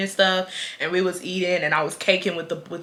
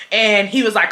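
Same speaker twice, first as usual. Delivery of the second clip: normal talking voice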